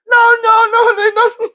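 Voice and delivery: high, loud, tearful cry of joy; imitating her overjoyed sobbing shout; happy crying shout. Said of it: "She called me crying".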